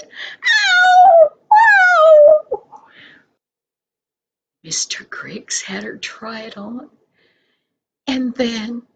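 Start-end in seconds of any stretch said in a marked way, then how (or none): 0.0-2.9 very high, loud squeal of joy; delighted squeal. Acted. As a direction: shriek like a thrilled child. Linda squeals over the blue coat.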